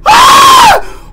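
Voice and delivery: shrill startled shriek; high scream. Real, not acted. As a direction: scream in real fright. Startled by game event.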